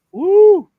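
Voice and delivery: high, loud "woo"; excited whoop. Real, not acted. Host welcoming a guest to the show, excited whoop. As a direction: let out a high cheering whoop.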